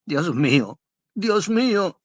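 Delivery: anguished cry. A narrator's reading. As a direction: cry out to heaven in despair. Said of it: "exclamó con acento desgarrador". (exclamación desgarradora y aguda)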